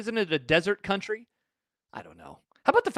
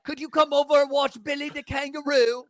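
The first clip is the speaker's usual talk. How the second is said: lightly hammed-up Australian accent, higher; mock Aussie accent; 6 semitones higher than usual. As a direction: play a cheery Aussie neighbour asking a favour. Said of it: "What accent is that?".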